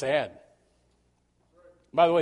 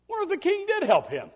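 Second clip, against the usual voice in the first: suspicious; 13 semitones higher, 4 dB louder than usual. Retold or imitated. Jealous people's suspicion voiced.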